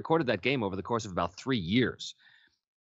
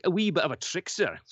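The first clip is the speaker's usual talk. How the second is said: lightly Scottish, high and louder; light Scottish musing; 7 semitones higher, 6 dB louder than usual. Retold or imitated. Actor recalling his idea for the character, lightly Scottish. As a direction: muse it with a wee Scottish lilt. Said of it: "And I thought".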